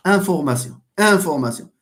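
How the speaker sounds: "information" said as French, an-for-ma-syon; French "information" with nasal first vowel and -syon ending; French pronunciation. Shown as a demonstration. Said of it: "French عندها".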